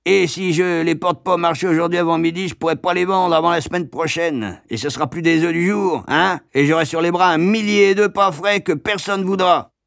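high, aggrieved rant; indignant egg carter. A narrator's reading.

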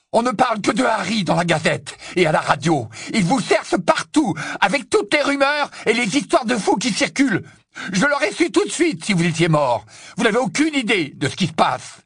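high, heated shouted argument; dominant sa voix. Acted. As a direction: keep bellowing over her. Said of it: "en dominant pour la première fois la voix d'Hermione". (plaidoyer crié, voix haute)

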